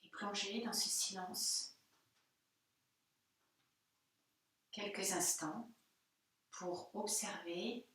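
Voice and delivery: soft, hushed meditation voice with spaced phrases; guided meditation. Real, not acted. Yoga teacher after the Om chant inviting students to observe their body, hushed meditative calm. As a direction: guide them softly through sensing the body, leaving room for silence.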